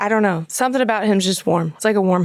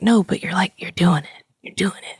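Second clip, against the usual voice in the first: whispered reassurance; 2 semitones lower than usual. Retold or imitated. Wife imitating her husband calming her first-night nerves, whispered reassurance. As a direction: whisper it to steady a nervous partner.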